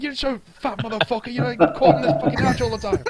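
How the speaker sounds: high, fast laughing insult; angry argument. Retold or imitated.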